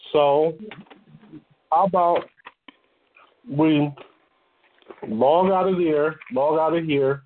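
talking while crunching a snack; words around a mouthful of chips. Real, not acted. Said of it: "excuse me if I'm crunching".

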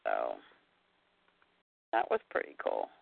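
soft whispered aside; barely voiced, hushed. Real, not acted.

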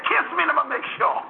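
high mock Haitian accent, insistent; high-pitched Haitian granny; Haitian old lady. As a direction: imitate a doubting old Haitian woman. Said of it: "she could hardly speak English".

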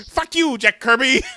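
high, loud mock taunt; Stan Lee. Host plays comic creator cursing his rival, high mock taunt. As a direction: crow it in a high put-on voice. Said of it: "and then he's like".

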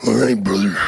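lightly voiced wrestler, low; a hint of a wrestler's voice; Macho Man. Macho greets the locker room, lightly imitated. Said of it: "Macho walks in".

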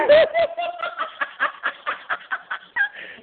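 long, high-pitched laughing fit. Real, not acted. Teased friend scolded about smoking, long high laughing fit. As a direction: crack up in high squeals.

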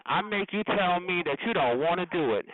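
ordinary talk

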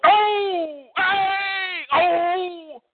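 high, far-off crowd cries; imitating a hollering crowd. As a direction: holler like a crowd egging on a fight. Crowd hollering at a street beating.

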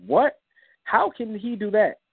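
high, slowed disbelief; high, slow astonished question; stunned disbelief. Retold or imitated. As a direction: let the surprise lift the pitch and slow down. Stunned by dad's praise.

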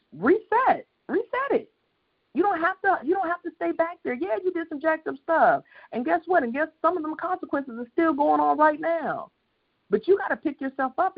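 high, ringing preacher's climb; full-force preaching. Acted. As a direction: lift the congregation, preaching hard. Preacher rising to full intensity.

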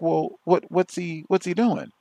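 high, puzzled mock officer asking; imitating an officer. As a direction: ask like a baffled cop. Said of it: "he'll be like".

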